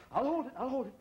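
high, strained wife holding back labor; straining wife. Acted. Wife at home about to give birth, strained high pleading. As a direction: squeeze it out high and tight, like a wife who can't wait.

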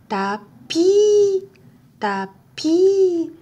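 "tapis" said loud and high, I ringing out; clear model word. Shown as a demonstration. Teacher makes learners hear the I in tapis. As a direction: call out "tapis" to the class. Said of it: "Est-ce que vous entendez le I?".

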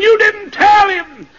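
high, loud retold scream; acted shout from hell. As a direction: cry it out in accusation, slowing at the end. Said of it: "I heard her screaming".

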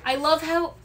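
ordinary talk, nothing marked

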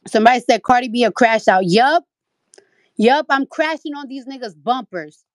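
loud fed-up rant; loud angry rant. Real, not acted. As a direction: yell it out, fed up and furious.

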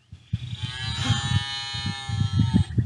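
a low breathy gasp of pretend amazement; playful fake gasp for a child; mock surprised gasp. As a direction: gasp as if the cow just mooed. Pretend reaction to cow mooing.

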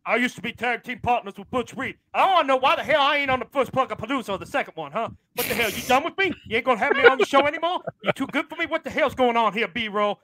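barge in furious, demanding answers; angry Ron. Acted. Ron crashing the show to yell at the host, high angry voice. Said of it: "He sounded pretty angry".

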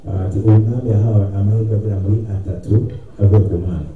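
ordinary delivery

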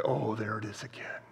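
whispered, delighted recognition. Shown as a demonstration. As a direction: breathe it out in wonder. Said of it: "you exercise your faith and you say".

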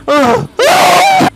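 ordinary talk

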